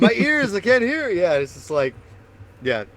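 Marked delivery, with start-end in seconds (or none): 0.0-1.1 high, loud mock old-age complaint; mock distress. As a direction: wail it in hammed-up dismay. Mock aches of getting old.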